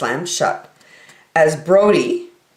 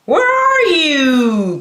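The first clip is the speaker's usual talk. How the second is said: calling out; 8 semitones higher, 3 dB louder, much slower than usual. Acted. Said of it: "called Brody".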